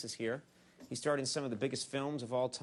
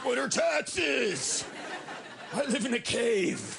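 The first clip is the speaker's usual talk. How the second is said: slow, higher dim-witted voice; dopey audience voice; 9 semitones higher, 4 dB louder, slower than usual. Acted. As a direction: play a clueless slacker. Voicing the unemployed audience.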